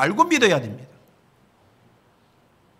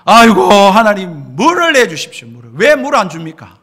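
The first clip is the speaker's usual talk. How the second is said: mock wailing plea; 12 dB louder than usual. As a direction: ham up the grumbling people begging God. Israelites wailing for water.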